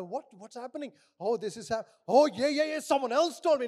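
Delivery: very loud, high-pitched busybody; excited mock gossip. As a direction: burst in, hammed-up and eager for the news. More members join the gossip.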